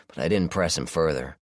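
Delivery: ordinary delivery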